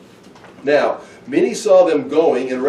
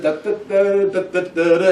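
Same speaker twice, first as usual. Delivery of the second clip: higher-pitched da-da-da ditty; mock trick fanfare; 5 semitones higher than usual. Jesus doing a wedding trick, mock fanfare. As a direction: voice a little magic-show tune. Imitating a magician's trick music.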